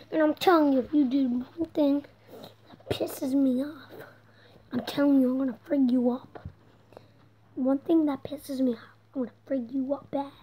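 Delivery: low, hushed mock warning; muttered threat. Warning the son to stay in bed, muttered threat. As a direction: play-act a quiet threat under the breath.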